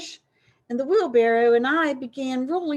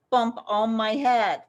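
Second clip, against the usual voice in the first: usual speaking voice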